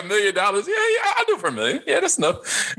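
squeaky high, laughing imitation; mock high voice. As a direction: imitate a greedy friend, high and giddy. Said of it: "you're like".

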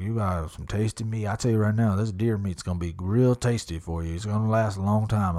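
put-on country twang, slightly low; Yellowstone cowboy drawl. Hunting guide promising tasty meat, cowboy drawl. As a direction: imitate a folksy cowboy guide. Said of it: "My whole voice changed".

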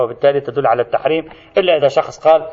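ordinary talk, nothing marked

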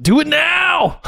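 shout. Real, not acted. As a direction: yell it out. Shouting a call to act.

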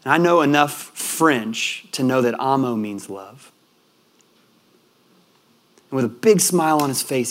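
usual speaking voice

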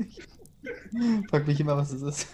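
talking through a high-pitched laugh. Real, not acted. Host puzzling over the knocking, laughing.

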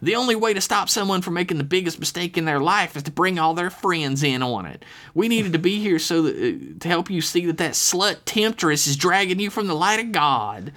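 high-pitched pious mother; scolding mother. A narrator's reading. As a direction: lecture your child righteously in a put-on female voice. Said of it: "she snapped back".

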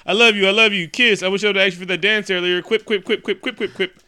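high, quick, hammed-up farewell; mock lovers' gushing. Cap and Agent Carter parting, mock gushing in a rush. Mocking the rushed farewell kiss.

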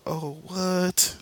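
high, hammed-up disbelief; shocked. Son told his dad is dead, shocked. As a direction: blurt it out high in mock shock. Barry hears startling family news.

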